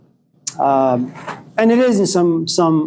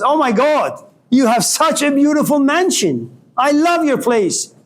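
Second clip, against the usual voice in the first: delighted chicken; 8 semitones higher than usual. A narrator's reading. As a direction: gush over the mansion as a chicken. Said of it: "the chicken goes into the camel's house and says".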